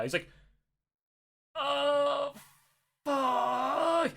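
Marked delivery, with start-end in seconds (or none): 1.5-4.1 high, drawn-out wail of dismay; mock dread. Jerry learns he is in North Korea, mock dread. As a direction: stretch each word into a thin comic whine.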